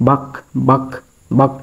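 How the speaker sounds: "বাক" with a lightly breathed b; between b and bh. Shown as a demonstration. Teacher demonstrating the in-between sound, "বাক" halfway b to bh. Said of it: "দুটোর মাঝামাঝি উচ্চারণ হবে".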